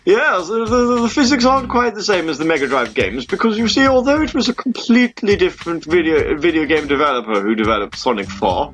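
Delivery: mock posh English. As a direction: lecture in a put-on posh English accent. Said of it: "It's supposed to be posh English".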